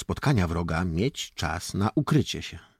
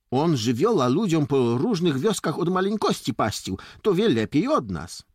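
put-on raised, higher boyish explanation; conceding boy; 9 semitones higher, 5 dB louder than usual. A narrator's reading. Jakub vouches that Dońka knows the paths, conceding. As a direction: admit it and explain, voice lifted. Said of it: "zgodził się Jakub".

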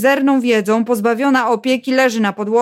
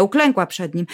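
ordinary delivery, nothing marked

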